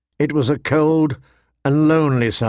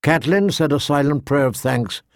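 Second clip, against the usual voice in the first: ordinary delivery, nothing marked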